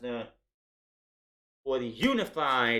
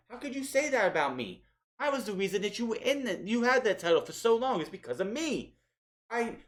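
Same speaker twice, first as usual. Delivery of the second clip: high, fast, pleading mock wail; hammed-up hurt manager begging; passionate whiny Heyman; 8 semitones higher than usual. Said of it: "He's like, ... getting very passionate".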